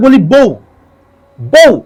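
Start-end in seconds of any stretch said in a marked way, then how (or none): 1.4-1.7 বউ with the উ cut off short; clipped final vowel. Shown as a demonstration. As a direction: stop বউ dead on the final উ. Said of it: "বউ, ধাক্কা মেরে থেমে যাচ্ছে".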